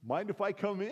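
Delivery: high-pitched eager request; Pastor Dave. Retold or imitated.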